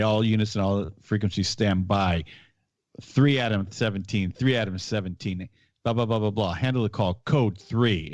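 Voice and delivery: usual speaking voice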